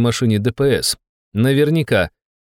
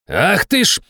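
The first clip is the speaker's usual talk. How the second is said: higher, indignant outburst; startled exclamation; 9 semitones higher than usual. A narrator's reading. Inspector nearly run over by the jeep, exclaiming. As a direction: exclaim it as if caught off guard. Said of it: "воскликнул инспектор".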